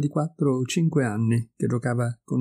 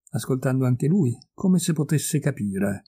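usual speaking voice

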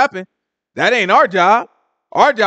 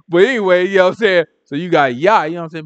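ordinary talk, nothing marked

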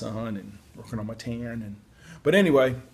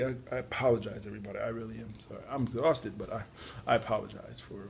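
ordinary talk

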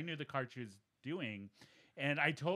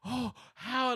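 high-pitched mock amazement, gasping between words; gasp, then squeak out each word in disbelief; astonished gasp; 11 semitones higher, 4 dB louder, much slower than usual. Client imitating psychic learning of kidney cancer, astonished gasping.

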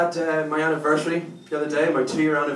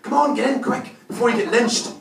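high, urgent acted old lady; 8 semitones higher than usual. Little old lady in a car offering rescue, urgent. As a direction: hurry them in with a raised pitch. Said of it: "this little old lady rolls down the window".

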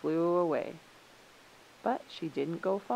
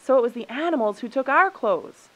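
loud, high counter-accusation; raised voice, heated retort; indignant shout; 7 semitones higher, 10 dB louder than usual. A narrator's reading. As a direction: shout the blame straight back. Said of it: "shouted the human beings".

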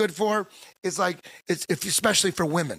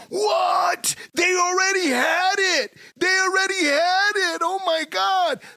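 incredulous shout; 12 semitones higher, slower than usual. Real, not acted. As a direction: yell it in total disbelief, eyes wide. Outraged at old news.

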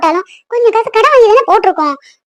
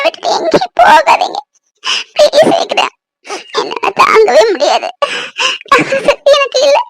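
crying; 5 semitones higher than usual. Acted. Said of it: "அழாத பூமாரி".

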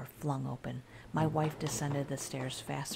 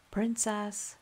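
calling the cat's name, mid-pitched; calling into a dark basement; medium tone; 7 semitones higher, 4 dB louder than usual. A narrator's reading. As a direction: call out Princess evenly. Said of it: "I said in a medium tone".

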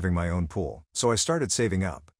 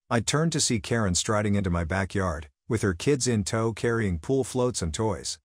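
ordinary delivery

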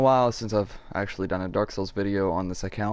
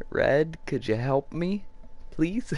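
higher, slow wheedling plea; playful pleading; 6 semitones higher, slower than usual. Pretend. Player asks Red for help, playfully pleading. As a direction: beg sweetly, pitch climbing to the end.

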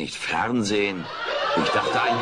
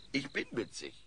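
much softer, muttered to himself; murmuring; 4 semitones higher, 14 dB quieter than usual. Acted. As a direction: mumble it as you doze off. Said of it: "murmelt Alf diese Worte vor sich hin". (vor sich hin murmeln)

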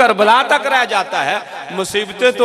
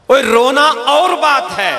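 sharp call-out; 8 semitones higher than usual. Real, not acted. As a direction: break off and call out to the mourners. Preacher breaks off to address mourners.

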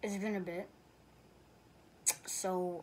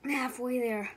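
excited; 5 semitones higher, 7 dB louder than usual. Real, not acted. Proud call mid challenge.

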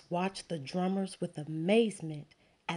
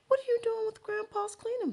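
much higher, fast motherly question; mother asking about the bucket, high and quick; mother's voice; faster than usual. Acted. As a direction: rush it out like a curious mom.

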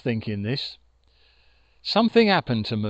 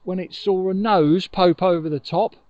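plain reading voice

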